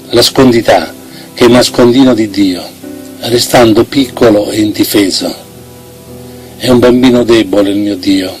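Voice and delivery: ordinary delivery, nothing marked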